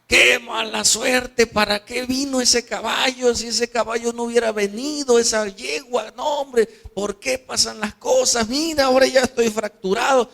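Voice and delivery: high, whining lament; lamenting son. Acted. Injured boy blaming the horse for his broken leg, despairing. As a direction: wail about bad luck like a despairing youth. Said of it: "¿Qué crees que dijo el muchacho?".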